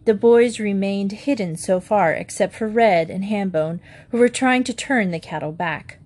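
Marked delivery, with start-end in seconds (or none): none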